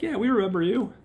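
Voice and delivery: put-on high, loud elderly elders; old elders' voice. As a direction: answer like a chorus of old men. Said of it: "they're like".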